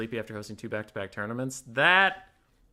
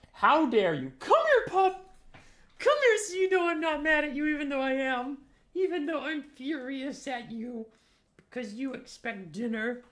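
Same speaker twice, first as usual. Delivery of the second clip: high, loud playful scold; mock scolding the dog; 12 semitones higher, 6 dB louder than usual. Real, not acted. Owner teasing his hungry dog Hazel, high mock scolding. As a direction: pretend fury at the pup.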